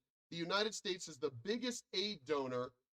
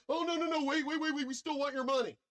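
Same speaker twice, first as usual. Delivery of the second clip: much higher and louder mock panic, rushing at the end; panicked countries; 11 semitones higher, 6 dB louder, faster than usual. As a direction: beg in a shrill fake panic. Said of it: "your countries lost their shit, like".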